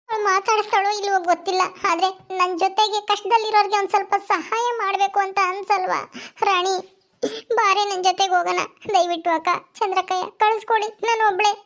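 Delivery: high-pitched voice of a weepy neighbour; tearful pleading woman. Acted. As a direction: beg tearfully for a favour.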